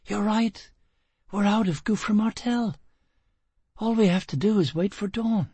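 lightly voiced young woman, amazed; Gisbella, hushed joy. Gisbella's voice, higher.

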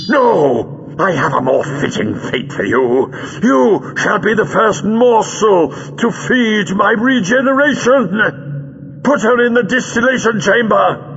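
high, slow, ranting relish; vengeful villain. A narrator's reading. Villain sentencing captive to feed his regeneration, vengeful.

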